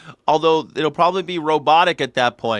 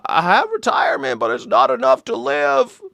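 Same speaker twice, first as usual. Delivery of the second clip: put-on high, old man's quavering complaint; old man still working; 9 semitones higher than usual. Comedian imitating old working man, high creaky voice. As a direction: whine like a broke elderly worker. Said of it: "The one that are like".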